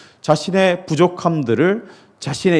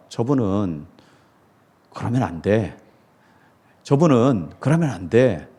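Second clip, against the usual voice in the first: judging; 5 semitones lower than usual. Retold or imitated. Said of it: "그렇게 생각하시잖아요".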